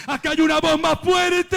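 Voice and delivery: high, ringing shouted refrain; climactic shout. Real, not acted. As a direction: proclaim it at full force.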